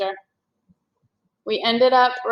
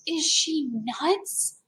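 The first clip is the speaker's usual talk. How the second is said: inner thought; 5 semitones higher, 7 dB quieter, much slower than usual. A narrator's reading. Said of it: "Jack thought".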